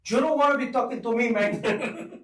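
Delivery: mock Spanish accent for the host. Shown as a demonstration. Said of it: "Let's hear it".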